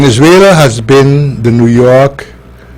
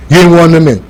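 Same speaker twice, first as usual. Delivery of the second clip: ordinary delivery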